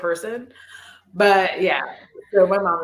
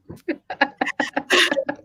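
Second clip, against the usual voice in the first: breathy laugh; 6 semitones higher than usual. Real, not acted. Laughing about mom's request.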